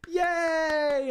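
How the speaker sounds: high, excited little cheer; mock cheer. Retelling the TikTok prank, a high excited cheer. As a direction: squeal it like a happy crowd.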